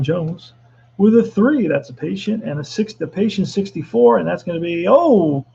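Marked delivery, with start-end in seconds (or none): 4.9-5.5 sudden oh. Real, not acted. Hit by pitch, sudden shock.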